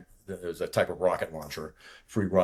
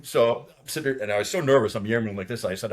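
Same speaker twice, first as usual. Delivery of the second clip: ordinary delivery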